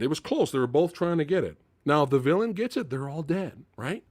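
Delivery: ordinary delivery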